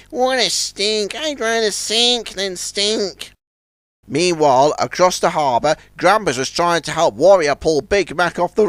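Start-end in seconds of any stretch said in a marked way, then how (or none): 0.1-3.3 slow, soft disgusted voice. Acted. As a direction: wrinkle the nose at the smell. Disgusted by waste barge.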